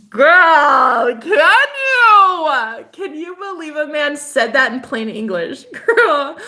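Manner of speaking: high loud squealing disbelief; excited disbelief. Real, not acted.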